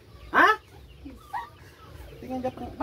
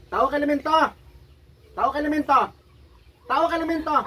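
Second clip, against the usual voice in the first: higher, louder interrogation; demanding shout; 8 semitones higher, 6 dB louder than usual. Real, not acted. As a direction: bark the question at the spirit. Healer demands spirit identify itself.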